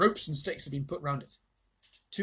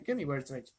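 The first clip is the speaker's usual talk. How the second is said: ordinary delivery, nothing marked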